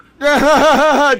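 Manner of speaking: loud, high burst of real laughter; sudden loud laugh. Real, not acted. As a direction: crack up at the clip. Reacts to the clip.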